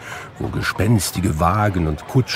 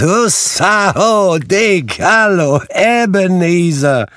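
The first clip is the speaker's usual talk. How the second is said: much higher and louder, hearty slow greeting; Fezziwig's jolly call; 9 semitones higher, 4 dB louder than usual. A narrator's reading. Fezziwig calling the boys for Christmas, a loud hearty shout. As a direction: hail the lads like a merry old master. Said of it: "rief Fezziwig".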